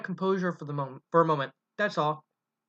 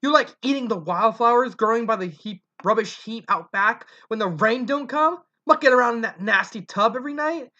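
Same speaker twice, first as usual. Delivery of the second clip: angry berating; 6 semitones higher, 5 dB louder than usual. Acted. Mother berating her son.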